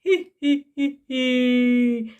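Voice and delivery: low gloating cackle; evil witch cackle. Acted. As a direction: cackle over your prey. Witch laughs at child.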